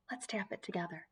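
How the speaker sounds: usual speaking voice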